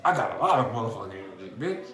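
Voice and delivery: quick, pumped-up mock exclamation; imitating a hyped-up version of himself; energized. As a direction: brag about all that new energy. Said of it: "You gonna be like".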